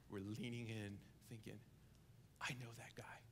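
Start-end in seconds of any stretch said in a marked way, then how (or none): 2.4-3.2 hushed whisper of recognition; whispered thought. Pretend. Listener whispering to himself, hushed whisper. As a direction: breathe it like a secret thought. Hearers thinking, whispered aside.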